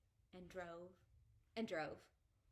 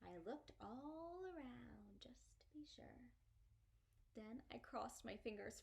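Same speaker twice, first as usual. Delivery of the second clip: whisper; 14 dB quieter than usual. A narrator's reading. Narrator whispering about looking for May. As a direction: let the listener in on a secret. Secretly hoping May won't come.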